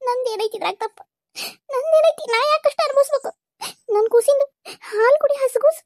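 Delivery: a heartbroken mother wailing through tears; anguished wailing. Acted. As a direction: cry out against fate, sobbing through the words. Mother wailing over her baby.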